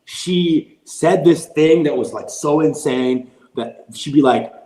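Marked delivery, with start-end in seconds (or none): none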